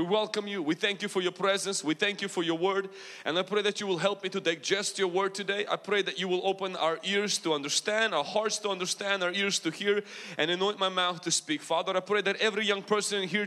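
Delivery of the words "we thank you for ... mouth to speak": ordinary talk, nothing marked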